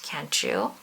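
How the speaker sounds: ordinary delivery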